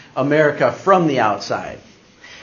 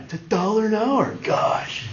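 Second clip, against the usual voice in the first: higher, put-on dorky voice ending in a voiceless sigh; Napoleon Dynamite; 6 semitones higher, 4 dB quieter than usual. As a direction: do an exasperated movie nerd. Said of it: "I feel like Napoleon Dynamite".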